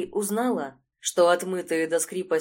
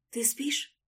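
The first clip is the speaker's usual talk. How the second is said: soft, higher little question; quiet voice; 7 semitones higher, 7 dB quieter than usual. A narrator's reading. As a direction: ask softly, not to wake her. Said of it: "услышала тихий голос Катеньки". (тихо, вполголоса)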